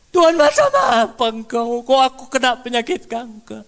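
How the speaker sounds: loud, high furious protest to the sky; high shouted accusation; angry cry to God. Retold or imitated. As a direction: rail at God, demanding answers. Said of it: "dia marah sama Tuhan".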